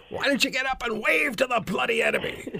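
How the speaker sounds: high-pitched mock military holler; imitated yelling officer. Petty officer berating a trainee sniper, mock yell. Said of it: "He always yelled".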